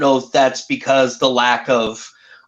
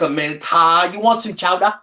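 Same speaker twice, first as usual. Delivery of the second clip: Maine accent impression; 5 semitones higher than usual. Mocking Maine accent, car and chowder.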